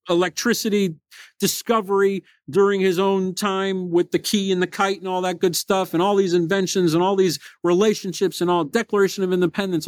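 ordinary delivery

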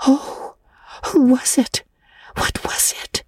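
breathless scared whisper, teeth chattering; frightened whisper. A narrator's reading. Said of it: "whispered Anne".

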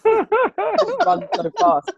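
high, slow words through laughter; laughing. Real, not acted.

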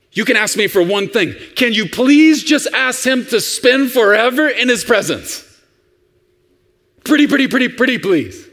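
put-on high, eager childlike begging; imitating a kid's insistent pleading; pleading little boy. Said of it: "The little boy says".

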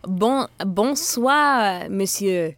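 hesitant French waiter greeting; slow, stumbling French accent; french chef. Acted.

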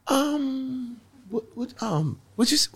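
a high, halting voice, pretending not to follow; high confused stammer. Comedian feigning confusion at the newcomer, high stammer. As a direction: play dumb in a high pitch. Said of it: "He goes".

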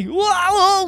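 high, wavering whoa; startled slipping. A narrator's reading. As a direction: cry out as if losing your footing. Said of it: "said the man as he started to slip".

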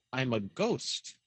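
low, slow and hushed; mock-spooky reply. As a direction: drop right down and draw it out, playing ghostly. Hacker's spooky answer to startled scammer.

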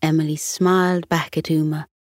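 ordinary delivery

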